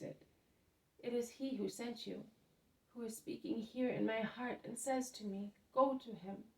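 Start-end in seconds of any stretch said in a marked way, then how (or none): none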